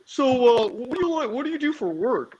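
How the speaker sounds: high, mocking imitation of eager guys; mock guys asking. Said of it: "like, asking".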